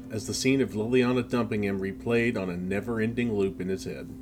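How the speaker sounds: ordinary delivery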